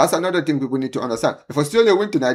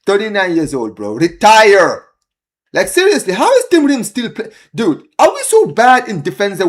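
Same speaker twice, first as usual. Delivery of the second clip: exasperated rant; 7 semitones higher, 6 dB louder than usual. Real, not acted. Ranting at aging defender.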